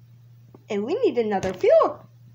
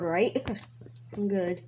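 ordinary talk, nothing marked

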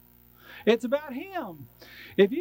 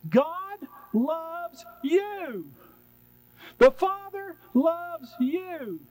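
tender reassurance; 7 semitones higher than usual. Real, not acted. Tender reassurance after rejecting fear.

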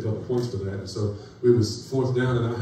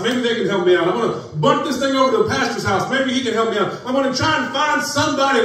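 desperate prayer; 9 semitones higher, 5 dB louder than usual. Acted. Voicing a cornered believer praying.

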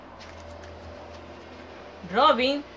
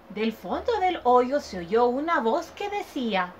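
plain reading voice

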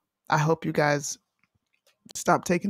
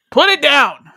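higher, louder order; loud command; 9 semitones higher, 13 dB louder, slower than usual. Pretend. Host loudly ordering Trump to drop the phone. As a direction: bark it like scolding a kid.

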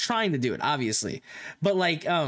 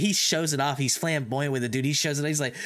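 usual speaking voice

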